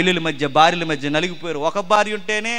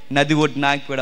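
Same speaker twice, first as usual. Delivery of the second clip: ordinary delivery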